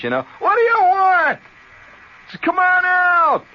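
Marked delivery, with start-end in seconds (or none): 0.4-1.4 loud high shout from inside the house; hollering back. Retold or imitated. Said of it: "So I, I hollered". 2.4-3.4 slow, loud, high call; buddy hollering from the back yard. Retold or imitated. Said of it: "You holler from inside the house".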